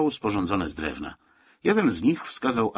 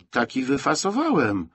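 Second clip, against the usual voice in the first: offended tone; 6 semitones higher than usual. A narrator's reading. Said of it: "rzekł obrażonym tonem góral".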